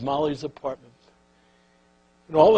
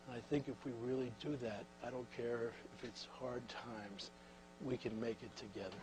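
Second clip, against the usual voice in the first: soft, much quieter closing blessing; speaker's hushed benediction; sincere; 3 semitones lower, 19 dB quieter than usual. Real, not acted. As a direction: bring it home gently.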